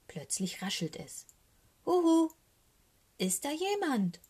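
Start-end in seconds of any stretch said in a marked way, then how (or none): 1.9-2.3 high, loud plaintive call; pitiful hoot of a hello. A narrator's reading. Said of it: "ruft Socke". 3.2-4.2 high, pitiful calling voice; frightened little raven calling, falling at the end; plaintive call. A narrator's reading. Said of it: "Seine Stimme klingt ganz kläglich".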